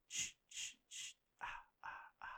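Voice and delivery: unvoiced ki-ki-ma-ma whisper; whispered Friday the Thirteenth sound. Asking about the horror film, whispering its signature sound. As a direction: breathe the slasher whisper softly. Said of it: "Then she goes".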